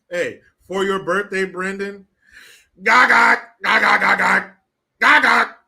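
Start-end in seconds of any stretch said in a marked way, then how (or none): 2.8-5.6 high loud mocking cackle, ga ga ga; Kamala cackle. Viewer's birthday request, so a loud mocking cackle. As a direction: cackle loudly like the vice president. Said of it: "Could you please do the Kamala cackle?".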